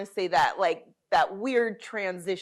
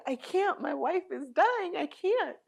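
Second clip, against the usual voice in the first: panicked husband; 8 semitones higher than usual. Retold or imitated. Husband panics, wife dying.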